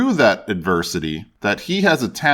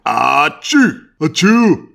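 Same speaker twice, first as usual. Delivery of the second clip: two loud hammed-up sneezes, the second higher; acted sneezes; 4 semitones higher, 6 dB louder, much slower than usual. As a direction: sneeze it out big for the bee. Bee sneezes in story.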